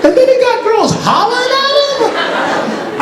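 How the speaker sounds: very high, drawn-out squealed marvel; mock envious amazement. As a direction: marvel in a slow, squeaky falsetto. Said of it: "and I said".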